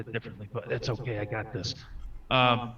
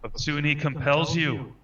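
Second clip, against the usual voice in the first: raised, high chant; mock exorcism; 7 semitones higher, 5 dB louder than usual. Player joking at the possessed creature, mock exorcism chant. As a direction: proclaim it like an exorcist.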